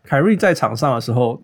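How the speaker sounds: ordinary talk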